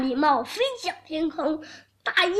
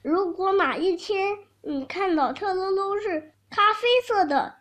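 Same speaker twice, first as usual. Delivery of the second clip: plain reading voice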